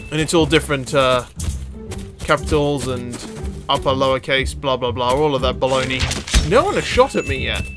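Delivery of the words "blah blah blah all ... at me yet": usual speaking voice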